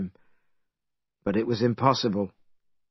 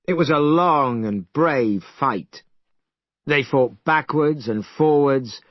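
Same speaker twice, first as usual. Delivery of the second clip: ordinary delivery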